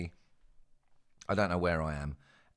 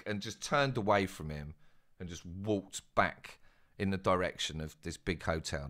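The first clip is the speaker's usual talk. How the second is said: ordinary delivery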